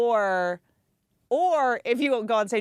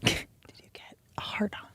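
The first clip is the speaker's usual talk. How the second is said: hushed mock ASMR; ASMR whisper; 6 dB quieter than usual. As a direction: close whispered question into the mic. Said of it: "do a little E S M R".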